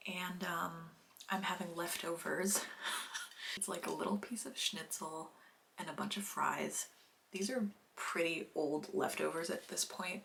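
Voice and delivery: quiet late-night whisper. Real, not acted. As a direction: whisper so the house stays asleep. Late-night whisper so nobody wakes.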